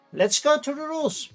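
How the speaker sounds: usual speaking voice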